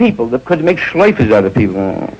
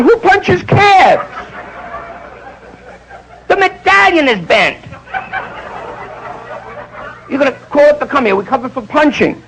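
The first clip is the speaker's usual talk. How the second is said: indignant cab driver; 10 semitones higher than usual. Retold or imitated. Angry cab driver voiced.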